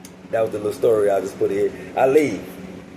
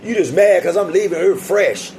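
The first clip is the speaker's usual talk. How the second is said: mouthing off at the guard, pitched up; talking trash; 7 semitones higher than usual. Retold or imitated. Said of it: "I'm talking shit".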